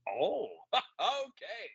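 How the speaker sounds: slow, high, laughing shrug of an okay. Retold or imitated. Podcaster mimics an actor dodging a question, slow and lazy.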